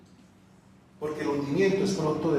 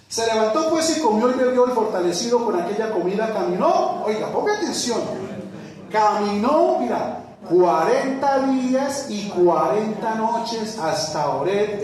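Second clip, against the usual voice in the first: high, loud, stretched pulpit delivery; fervent preaching; 8 semitones higher, 5 dB louder than usual. Acted. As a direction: drive the scripture home to the church. Preaching at full force. (predicación enérgica, aguda y fuerte)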